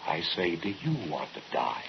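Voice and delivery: hushed, ominous repeat of the question; low menace. Acted. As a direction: drop it low and soft, menacing. Brother presses the question again.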